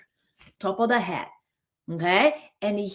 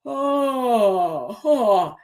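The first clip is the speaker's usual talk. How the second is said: two open-jawed yearning "oh"s, louder; yearning sigh; 4 dB louder than usual. Shown as a demonstration. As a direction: sigh out a longing "oh, oh". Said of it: "Drop your jaw as if you're yearning".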